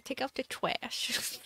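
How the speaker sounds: put-on slow, soft whispered baby talk; whispered uwu teasing. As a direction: coo it back at the boyfriend, cutesy. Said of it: "saying things in an uwu voice".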